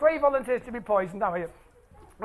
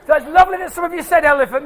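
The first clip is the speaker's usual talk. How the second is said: ordinary delivery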